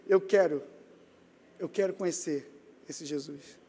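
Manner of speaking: low and halting, fading almost to nothing; retold tearful plea. Sailor in tears asking to know Jesus, tearful and fading. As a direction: imitate a man too moved to speak up. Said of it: "Ele em lágrimas".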